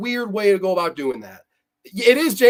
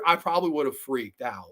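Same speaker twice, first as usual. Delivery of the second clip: ordinary delivery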